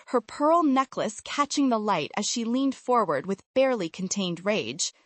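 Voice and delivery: ordinary delivery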